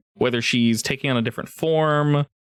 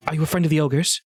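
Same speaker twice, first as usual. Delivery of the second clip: water nymph; 4 semitones higher, faster than usual. Acted. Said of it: "Leka, she's like".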